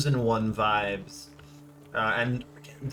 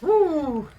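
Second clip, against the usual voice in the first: high, loud relieved whoop; relieved woo; 6 dB louder than usual. Real, not acted. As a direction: let the stress out in a whoop. Relief after stressful stealth segment.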